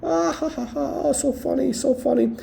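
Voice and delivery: high, hammed-up fake laugh at a scam; sneering imitation of laughing skeptics; mocking laughter. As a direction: mimic the doubters cackling at you. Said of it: "Everybody was laughing".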